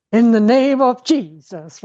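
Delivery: imitating a fervent prayer leader; mock preacher prayer. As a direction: ham up the church lady casting out sadness. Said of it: "she gives me the whole fucking Jesus thing".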